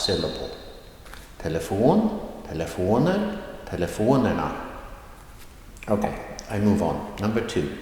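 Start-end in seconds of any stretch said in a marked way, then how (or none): 1.4-4.5 "Telefon, telefoner, telefonerna" in a brisk series, each lifted on "fon"; rising tone drill. Shown as a demonstration. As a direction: raise "fon" in all three, falling only after it in "telefonerna". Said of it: "a rising tone on the third before last".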